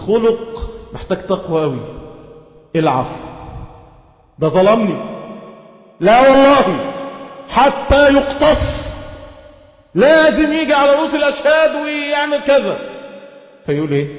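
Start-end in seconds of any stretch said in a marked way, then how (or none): 4.4-12.8 aggrieved complaint. Retold or imitated. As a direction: act out a wronged man refusing to forgive. Voicing an aggrieved wronged man. (تقليد غاضب لشخص مظلوم يرفض العفو)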